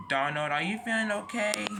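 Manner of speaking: put-on a young engine asking with worry, pitched high; high Thomas voice. Thomas asks Donald if he is okay, in a high worried voice. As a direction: lift the voice way up for Thomas, concerned.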